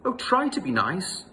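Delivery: high, loud frightened plea; whimpering lion. Acted. Lion begging the mouse, high and scared. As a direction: beg in panic. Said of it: "he whimpered".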